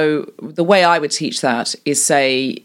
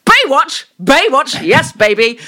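higher, louder and gleeful; enthusiastic; 8 semitones higher, 5 dB louder, slower than usual. Shown as a demonstration. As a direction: cheer it like a fan. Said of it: "They're very enthusiastic".

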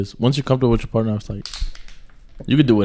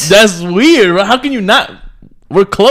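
incredulous; 7 semitones higher, 5 dB louder, slower than usual. Real, not acted. Incredulous friend arguing.

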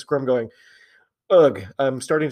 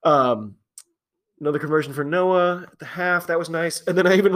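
ordinary talk, nothing marked

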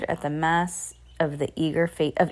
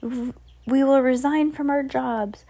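high, eager pledge; high-pitched crowd assent; crying out; 7 semitones higher, 3 dB louder than usual. A narrator's reading. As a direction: promise it high, like obedient followers. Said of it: "they all cried".

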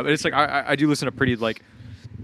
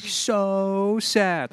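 mock sad; 8 semitones higher than usual. Mock sad synth song.

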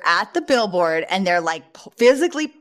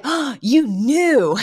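high, slow "oh you knew"; mock surprise; 6 semitones higher, much slower than usual. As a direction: drag it out like a caught-out nanny. Acting Fran's realization.

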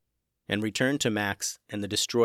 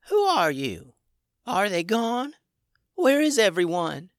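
higher, dazed little dragon; a small creature waking, confused; 9 semitones higher, 3 dB louder than usual. A narrator's reading. Injured little dragon wakes up.

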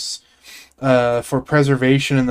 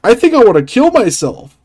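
hammy Ray Romano; 7 semitones higher, 4 dB louder than usual. Pretend. Still in Ray Romano bit.

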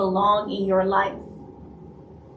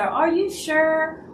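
doubtful quote; 7 semitones higher, slower than usual. Retold or imitated.

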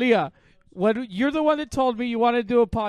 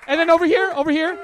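loud, higher-pitched call across the room; 6 semitones higher, 8 dB louder than usual. Real, not acted. Host directing a noisy group, calling out. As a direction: call over the noise for attention.

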